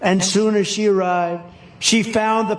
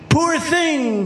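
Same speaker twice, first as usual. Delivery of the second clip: sighing; 8 semitones higher than usual. A narrator's reading. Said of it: "she sighed".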